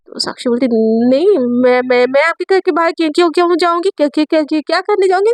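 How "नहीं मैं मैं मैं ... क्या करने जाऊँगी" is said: rising, flustered stammer that repeats words; stammering denial. Acted. Student caught lying stammers to her teacher. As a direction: trip over every word while denying. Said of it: "हकलाने लगती है".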